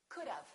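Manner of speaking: "could've" said quick, like could-of. Shown as a demonstration. Teacher shows the natural "could've". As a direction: blend "could've" into one beat. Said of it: "and say coo-dove".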